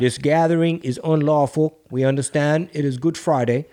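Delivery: put-on a light Boston accent, clipped formal phrasing; hint of a Kennedy-style Boston accent; police announcement in a mild Boston accent; JFK Boston accent. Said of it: "Sound like J F K".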